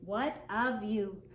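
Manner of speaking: ordinary delivery, nothing marked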